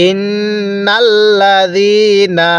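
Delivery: ইন্নাল্লাযীনা with the ন্ন held through the nose, slow; nasal ghunnah. Shown as a demonstration. Teacher shows nasal ghunnah in ইন্নাল্লাযীনা. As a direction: hum the নুন of ইন্নাল্লাযীনা nasally. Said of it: "নাকের বাসি দ্বারা গুন্নাহ করব".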